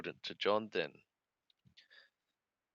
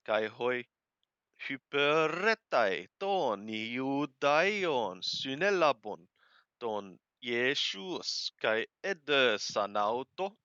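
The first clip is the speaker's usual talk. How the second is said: slow, louder, measured reading of the Greek text; scripture reading; 4 semitones higher, 7 dB louder, slower than usual. Acted. Teacher reading John aloud to the class, slow and measured. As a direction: read each phrase aloud slowly as holy text.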